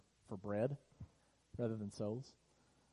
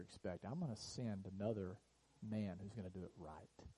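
lower, softer, tender promise; gentle promise of God; 3 semitones lower, 6 dB quieter than usual. Pretend. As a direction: speak as God reassuring the fallen, lower and soft. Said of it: "He says".

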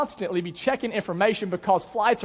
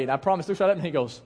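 ordinary talk

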